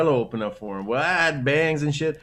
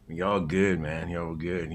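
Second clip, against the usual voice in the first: dude at a bar; 8 semitones lower, 7 dB quieter, slower than usual. Retold or imitated. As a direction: lower and softer, a mock laid-back guy. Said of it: "some guy would go".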